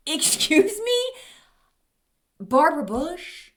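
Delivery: high, stunned disbelief; mock incredulous. To the consultant who compared her to Barbara Bush, high and incredulous. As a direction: repeat the insult back in disbelief.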